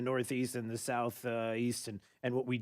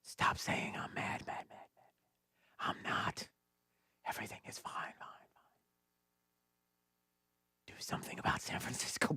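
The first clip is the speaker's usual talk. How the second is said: whispering Mother Nature; 3 semitones lower, 9 dB quieter than usual. Pretend. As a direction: whisper like the wind talking. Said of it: "I can't do it".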